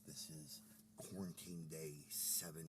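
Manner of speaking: very low, soft, slow report voice; hushed low reporter intro, drawn out; mock field report. Reporter opens a quarantine diary, low and solemn.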